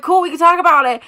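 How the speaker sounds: high, loud mock chirp; peppy imitated texting voice; eager retelling. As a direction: pitch it up like an enthusiastic reply. Retells excited texting with friend.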